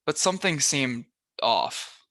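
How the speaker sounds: usual speaking voice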